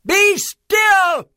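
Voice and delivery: put-on very high, loud child's command; commanding shout. A narrator's reading. Max ordering wild things to stop, high commanding shout. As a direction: shout it high to halt the monsters. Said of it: "till Max said".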